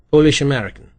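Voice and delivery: put-on loud reply with a trace of Polish accent; lightly Polish-accented correction. A narrator's reading. Suspect corrects detective about his origins, light Polish accent. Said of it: "with the lightest trace of accent".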